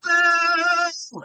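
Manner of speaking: very high loud boo; crowd boo. Retold or imitated. Crowd booing the compere again, loud boo. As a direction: boo with full force. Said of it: "turned back to me and went".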